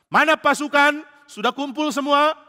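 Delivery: loud, high roll call of an army commander. Acted. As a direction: bark out the troop check across the field. Commander checking troops, calling out.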